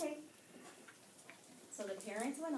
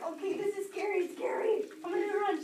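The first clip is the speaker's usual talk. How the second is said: higher, louder, rising panic; panicked; 8 semitones higher, 7 dB louder than usual. Retold or imitated. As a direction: voice the girl's fear as she bolts. Girl panics, decides to run.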